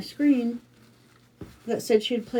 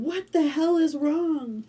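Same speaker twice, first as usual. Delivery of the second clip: higher, drawn-out imitation of a worried husband; imitated alarm; 8 semitones higher, slower than usual. Husband hears wife crying on the phone, imitated alarm. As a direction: stretch it out high, as a retold panic.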